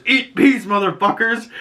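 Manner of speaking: high, hammed-up action-hero yell; mock battle cry. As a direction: yell it like a grenade-throwing hero, half laughing. Acting action hero's battle cry.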